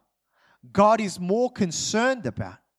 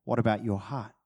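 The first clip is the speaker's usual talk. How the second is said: God's voice; 8 semitones lower, 6 dB quieter than usual. Retold or imitated. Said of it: "And God would say".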